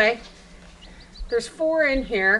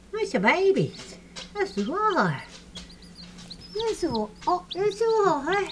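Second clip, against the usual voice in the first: higher-pitched doting coo; 6 semitones higher than usual. Real, not acted. Keeper praising the babies, high doting baby talk. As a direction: coo praise at little animals.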